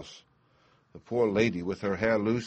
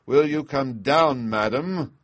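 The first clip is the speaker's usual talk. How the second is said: much louder, higher, slow furious roar; greatest rage; 5 semitones higher, 8 dB louder, slower than usual. A narrator's reading. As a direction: spit each word out in fury. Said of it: "said Bluebeard in the greatest rage".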